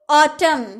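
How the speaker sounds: ऑटम ending on m; ऑटम without the n; silent n. Shown as a demonstration. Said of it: "एन का उच्चारण आप नहीं करिएगा". (ऑटम, न नहीं बोलना)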